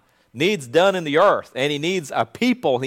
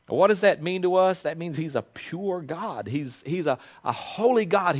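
usual speaking voice

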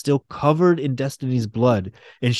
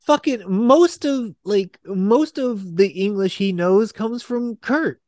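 pitched way up in disbelief; high incredulous rant; 7 semitones higher than usual. Real, not acted. Fan ranting about how Colossus really talks, high and incredulous. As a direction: squeal it out, can't believe it. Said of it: "that's not how he talks".

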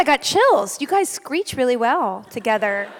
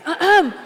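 higher mock throat-clearing; imitated summoning cough; 5 semitones higher than usual. Baby treats mother like an usher, summoning cough. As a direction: mimic an imperious "ahem" for service. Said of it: "I'm an usher or something".

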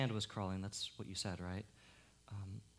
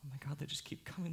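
usual speaking voice

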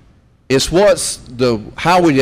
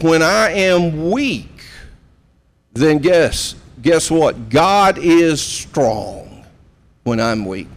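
slow emphatic quote; 2 semitones higher, slower than usual. Retold or imitated. Pastor quoting Paul to congregation.

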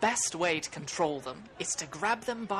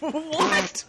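loud, high, laughing "what"; real disbelief; 10 semitones higher, 4 dB louder than usual. Real, not acted. Game master hearing of a bush illusion, amused disbelief. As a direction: burst out incredulous.

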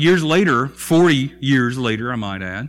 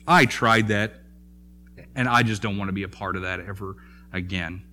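weary muttered refusal; 6 semitones lower, 5 dB quieter than usual. Retold or imitated. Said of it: "he said".

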